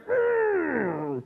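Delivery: high, tight mock baby grunt. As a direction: grunt like a clenched newborn. Newborn voiced by preacher.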